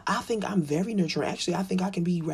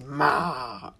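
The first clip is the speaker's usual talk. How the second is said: put-on whiny baby call; 5 semitones lower, 6 dB louder than usual. Baby whining.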